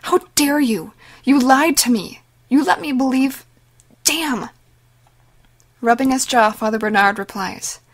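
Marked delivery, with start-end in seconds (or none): 0.0-4.5 higher and louder, betrayed and furious; hurt disbelief breaking into anger; incredulous fury. A narrator's reading. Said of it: "she says incredulously".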